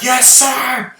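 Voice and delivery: very high, loud acknowledgement; shouted military reply. Retold or imitated. Soldier answers his general's deadly order, eager high-pitched shout. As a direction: shout back like an obedient soldier.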